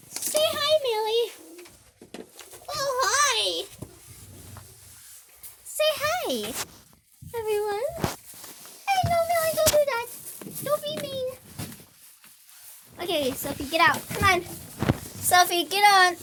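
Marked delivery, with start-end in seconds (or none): 0.1-11.3 pet voice. Real, not acted. Talking to her kitten Millie, soft high pet voice. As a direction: coo at the kitten.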